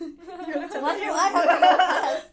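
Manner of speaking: high, loud laughing question; laughing protest. Real, not acted. Asked to share first, laughing loudly.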